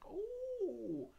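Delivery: high, swooping cooing gush; hammed-up high-pitched admiration; impressed audience. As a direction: gush slowly like dazzled fans. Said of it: "people were like".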